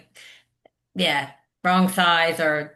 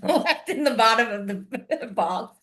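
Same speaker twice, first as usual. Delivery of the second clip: laughing; 4 semitones higher, slower than usual. Real, not acted. Laughs mid sentence.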